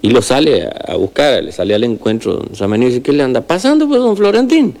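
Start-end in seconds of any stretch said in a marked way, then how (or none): 3.0-4.7 high-pitched rural healer greeting a neighbour; old healer voice. Acted. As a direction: pitch the healer much higher, friendly country greeting. Healer asks what's wrong.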